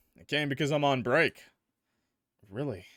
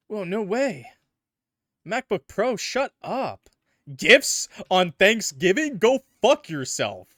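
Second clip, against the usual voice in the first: loud, high incredulous outburst; disbelieving shout, playful; excited; 7 semitones higher, 8 dB louder, slower than usual. Real, not acted. Mock outrage at gifts.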